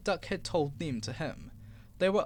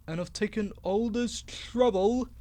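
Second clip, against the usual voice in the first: near shriek; 3 semitones higher, 3 dB louder than usual. Acted. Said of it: "he almost shrieked".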